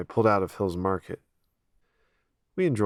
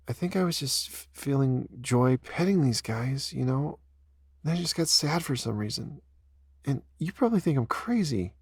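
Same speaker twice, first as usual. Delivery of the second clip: tearful Ella; 6 semitones higher than usual. Acted. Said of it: "Ella tearfully explains".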